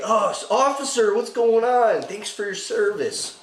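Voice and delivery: high-pitched, over-polite hello to an officer; mock-friendly greeting. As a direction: play innocent with exaggerated politeness. Acting out greeting to police.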